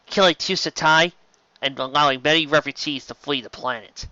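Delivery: ordinary delivery, nothing marked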